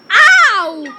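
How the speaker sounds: very loud "ow"; sudden full-volume cry of ow; loud yelp. Real, not acted. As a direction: yelp out loud on getting hit in the game. Hurt or killed in the game.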